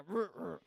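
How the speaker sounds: low, rolled bullfrog croak; bullfrog croak imitation. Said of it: "this one is like a".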